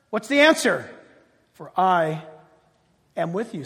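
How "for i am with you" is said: lower, softer, hushed promise from God. Shown as a demonstration. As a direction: speak it low as God's quiet assurance. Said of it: "says God".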